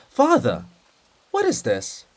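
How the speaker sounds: loud high call. Acted. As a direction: call him, puzzled. Calls sleeping poet.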